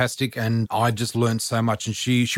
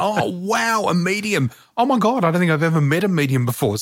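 much higher, wide-eyed gushing; mock amazement; 6 semitones higher than usual. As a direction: play up your wide-eyed awe. Retelling her amazed reaction.

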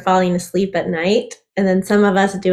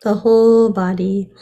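guided meditation; 7 dB quieter than usual. Acted. Guides a breathing exercise.

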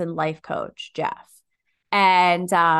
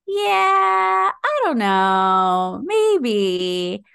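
drawn-out, loud mock-whine; whiny waffling; 5 semitones higher, 5 dB louder, much slower than usual. Her old self answering the coach's question, whiny waffling. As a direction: waffle in an exaggerated, stretched-out whine.

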